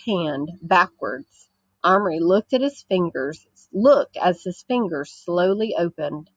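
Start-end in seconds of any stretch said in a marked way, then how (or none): none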